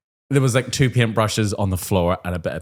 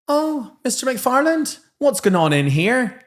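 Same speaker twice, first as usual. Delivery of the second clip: slow, much higher falsely sweet enquiry; mock innocent teacher; 13 semitones higher, slower than usual. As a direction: play dumb as you barge into a colleague's telling-off. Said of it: "and you're like".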